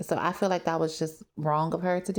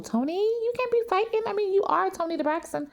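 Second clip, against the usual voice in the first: high-pitched Trina plea; mock pleading; 13 semitones higher than usual. Trina pleading with Toni in a high mock voice. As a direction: beg her not to fight, pitched high.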